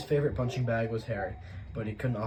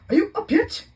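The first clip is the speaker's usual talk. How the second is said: much higher, loud, sharp demand; Aunt Petunia demanding; 14 dB louder than usual. A narrator's reading. Aunt checking on the boy, loud and high. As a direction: needle him through the door. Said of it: "she demanded".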